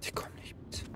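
tense hushed whisper. Real, not acted. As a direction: whisper so they can't hear. Hiding from pursuers in game.

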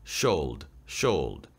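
low "should" with sh in one sound, like "shold"; falling "should", demonstrating a single sh. Shown as a demonstration. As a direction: blend s and h at the start of "should". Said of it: "에스에이치를 묶음으로 한 번에 발음을".

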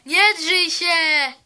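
loud slow don't-yell; shout back. Real, not acted. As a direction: yell at her to stop yelling. Telling other to stop yelling.

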